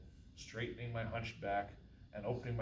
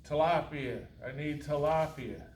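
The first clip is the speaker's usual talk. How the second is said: curt demand; 4 semitones higher, 7 dB louder than usual. Acted. Customer demands fish.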